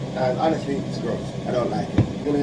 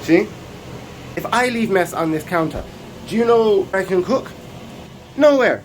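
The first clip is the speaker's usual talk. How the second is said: loud, high exasperation building to an outburst; exasperated rant; 7 semitones higher, 3 dB louder than usual. Real, not acted. Cook ranting about no counter space, exasperated. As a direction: complain louder and higher to the last word.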